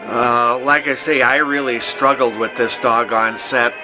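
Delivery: ordinary talk, nothing marked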